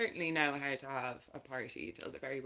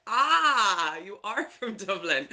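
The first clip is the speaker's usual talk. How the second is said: delighted Canadians; 3 semitones higher, 9 dB louder, much slower than usual. Retold or imitated. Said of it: "They're like".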